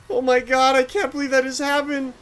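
normal talking voice

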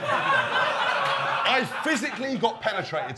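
high, slow, laughing drawn-out protest; mock outraged disbelief. Telling his coaches about the ear incident, slow mock outrage. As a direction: stretch every word in comic indignation.

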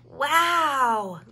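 stunned by the waterfall; amazed wow. A narrator's reading. Little bear gasping at the big waterfall. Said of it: "gasped Murray".

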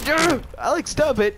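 high, loud protest; worked-up gamer shout; exasperated yell. Real, not acted. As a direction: yell at a friend to quit it. Under fire, yelling at friend.

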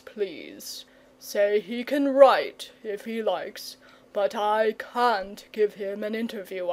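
slow, lazy dragon voice, fussily refusing; a timid dragon hiding from the world; fretful dragon. Acted.

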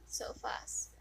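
hushed remark; whispered aside. Real, not acted. Player muttering to herself, whispered. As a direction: breathe it out.

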